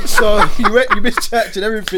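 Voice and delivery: high squeal of laughter; laughing. Real, not acted. Laughing reaction.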